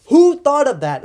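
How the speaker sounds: loud, high-pitched incredulous question; exasperated outburst. Real, not acted. Reviewer ranting about tracer ammo like lasers, exasperated. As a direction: burst out in loud disbelief.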